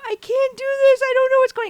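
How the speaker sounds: high, loud tearful whine; mock crying. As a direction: wail helplessly, hammed up. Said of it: "I'm crying".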